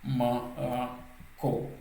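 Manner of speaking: Ma, ra, ko as three separate beats; syllable by syllable. Shown as a demonstration. Teacher counting three syllables for learners, Ma, ra, ko spaced apart. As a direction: break the word into Ma, ra, ko with gaps. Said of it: "donc trois syllabes".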